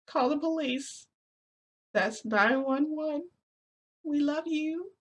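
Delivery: put-on high, slow, tearful plea to a lost child; emotional game character line. Vlogger reenacting a game scene to her viewers, high pleading voice. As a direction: act out the sad last line, voice way up. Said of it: "my last one was".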